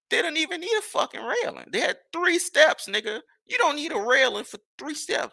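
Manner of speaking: high-pitched, incredulous complaint; worked-up, high-voiced griping; indignant protest. Real, not acted. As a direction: protest it high, drawing out the key words. Griping about a needless railing.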